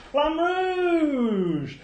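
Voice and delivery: high, loud announcer-style shout of the title; theatrical French flourish; grand reveal. Acted. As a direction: belt it out like a game-show reveal. Game-show reveal of surprise game.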